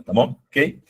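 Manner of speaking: ordinary talk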